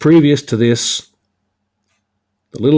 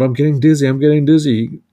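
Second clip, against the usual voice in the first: higher-pitched dizzy plea; retold pleading; mock begging; 5 semitones higher, 3 dB louder than usual. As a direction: beg lightly, imitating a poisoned villain. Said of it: "starts begging and whatnot".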